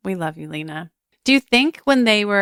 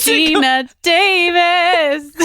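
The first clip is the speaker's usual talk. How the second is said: much higher, slow, laughing it out; laughing correction; 9 semitones higher, 4 dB louder, much slower than usual. Real, not acted. Correcting her actress mix-up to cohosts, high laughing. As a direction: giggle the right name out.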